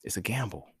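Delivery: whispered, no voice at all. Shown as a demonstration. Musing on faith, whispered to himself. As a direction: breathe it out like a secret. Repeats line whispered, then plain.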